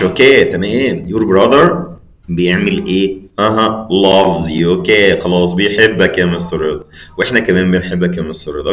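usual speaking voice

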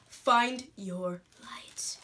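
breathy whisper, slow and drawn out; whispered quote. Retold or imitated. Whispering the notebook's words to viewers. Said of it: "and it says".

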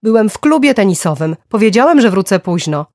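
loud, high, exasperated justification; heated protest; angry self-defence. A narrator's reading. Defends himself in the quarrel.

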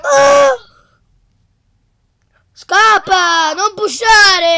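loud frantic yelling; panicked shout. Real, not acted. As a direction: yell in panic to flee. Fleeing enemy in game.

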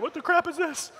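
high-pitched mock complaining kid; comic incredulous youngster; baffled teen. Voicing a baffled teen attendee.

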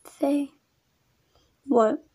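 usual speaking voice

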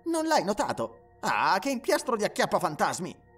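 loud, high, incredulous scolding; exasperated teasing. Acted. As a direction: chide a clueless friend with playful scorn. Mocks friend for missing it.

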